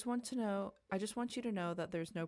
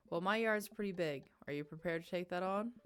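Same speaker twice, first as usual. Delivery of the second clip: usual speaking voice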